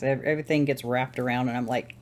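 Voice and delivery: normal talking voice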